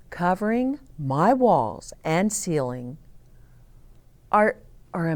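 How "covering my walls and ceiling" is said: ordinary delivery, nothing marked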